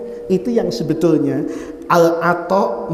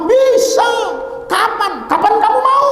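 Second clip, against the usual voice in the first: high, exclaimed mock assurance; emphatic promise; 13 semitones higher than usual. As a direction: call it out with total confidence. Over-confident promise to favour-seeker. (seruan yakin, nada tinggi)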